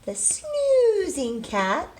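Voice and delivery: playful read-aloud emphasis; drawn-out storybook phrase. Acted. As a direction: sing out snoozing slowly. Teacher dramatizes sleepy story character.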